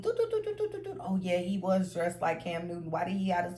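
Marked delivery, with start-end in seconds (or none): 0.0-1.0 high hummed doo doo doo; mind replaying tune. Telling the story, she hums her brain replaying things. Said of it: "my brain starts replaying certain things".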